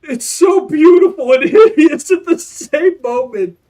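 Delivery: loud, high, laughter breaking through; laughing while talking. Real, not acted. Streamer laughs over her beautiful hideous design, loud and high. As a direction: giggle through the words.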